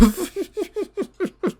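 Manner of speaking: high, rapid giggle; helpless high chuckling; laughing. Real, not acted. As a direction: break into real high-pitched laughter. Laughs at people he mocks.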